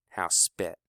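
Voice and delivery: plain reading voice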